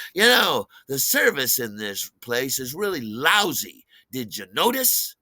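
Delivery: loud, pointed opening jab; mock a rude drunk customer; belligerent complaint. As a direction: needle the waiter loudly. Belligerent diner complains, retold.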